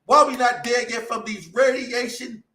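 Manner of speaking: high-pitched, exultant preacher with drawn-out key words; full-force preaching. Real, not acted. Preacher promising deliverance to Israel, ecstatic high-pitched preaching. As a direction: preach it high, in bursts of loudness.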